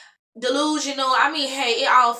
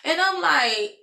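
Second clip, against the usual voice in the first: ordinary talk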